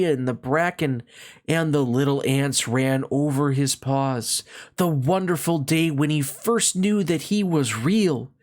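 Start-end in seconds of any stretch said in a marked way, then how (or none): none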